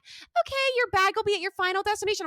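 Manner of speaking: very high, sing-song agent voice; mock airline staff.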